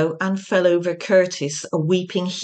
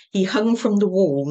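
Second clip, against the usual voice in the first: plain reading voice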